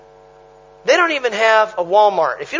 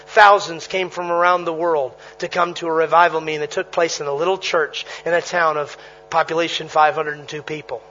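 ordinary delivery

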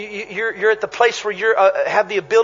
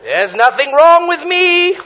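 forced cheer; 6 semitones higher, 4 dB louder, slower than usual. Retold or imitated. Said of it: "you want the people to see you on the stage".

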